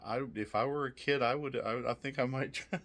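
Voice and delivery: normal talking voice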